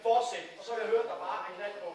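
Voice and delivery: højt, energisk regi; højere instruktion. Real, not acted. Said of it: "instruktør hæver stemmen". (høj, energisk instruktion)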